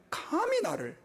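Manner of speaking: an arrogant believer's high indignant cry; indignant outburst. Retold or imitated. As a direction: fling it out in proud outrage. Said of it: "은혜롭지 않은 교회는".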